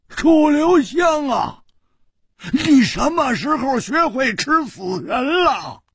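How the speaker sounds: slow, high bellow across the graveyard; big shout. A narrator's reading. Hu Tiehua jumps up and bellows at Chu Liuxiang, slow and high. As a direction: roar it out in shock. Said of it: "大喝说".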